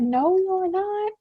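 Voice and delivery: high, drawn-out mock mom voice; mother's refusal. Student imitating mom vetoing the hot dogs, high drawn-out refusal. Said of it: "My mom would have said".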